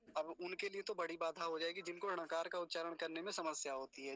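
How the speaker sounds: normal talking voice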